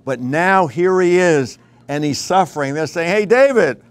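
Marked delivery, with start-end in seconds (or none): 3.1-3.8 high, loud taunting jeer; mocking crowd. Crowd taunting suffering David, loud mocking jeer. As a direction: sneer it up at David like a mocker. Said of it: "That, that's the mockery".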